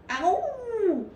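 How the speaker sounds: high, loud little cry of pain; cried out. Acted. Pooh yelps as Rabbit pulls his paw, high loud cry. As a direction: yelp it like a bear being pulled. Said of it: "cried Pooh".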